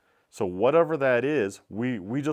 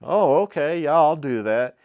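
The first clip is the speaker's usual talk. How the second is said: higher, louder, mock-eager yes; mock eager shopkeeper; 5 semitones higher, 8 dB louder than usual. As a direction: cave in brightly, pitched up and loud. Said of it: "then they say".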